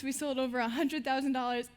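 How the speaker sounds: ordinary talk, nothing marked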